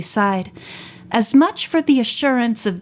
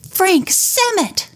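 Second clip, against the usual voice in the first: high, slow shocked recognition; surprised cry; 10 semitones higher, slower than usual. A narrator's reading. Nancy recognizing the fallen man, high slow shocked cry. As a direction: blurt out the name in disbelief. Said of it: "Nancy cried".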